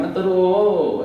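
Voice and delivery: high, slow, drawn-out coaxing; a mother gently hushing her baby. Retold or imitated. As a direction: stretch it out to hush a crying child. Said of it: "चुप कराती हैं".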